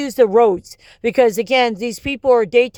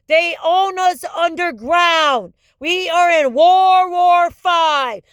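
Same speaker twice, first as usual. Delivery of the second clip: higher, slow, hammering alarm; shouted warning; 5 semitones higher, slower than usual. Real, not acted. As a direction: drive the warning home at a yell.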